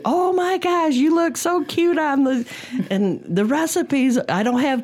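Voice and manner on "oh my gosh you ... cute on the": high, gushing excited voice; gushing cousin. Retold or imitated. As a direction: read the text like a thrilled cousin. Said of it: "my cousin from Chicago text me".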